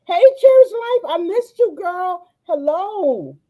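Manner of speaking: high, gushing hello; high-pitched, loud opening, easing off; excited greeting. Real, not acted. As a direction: squeal hello to a long-missed friend. Greeting a missed friend on stream.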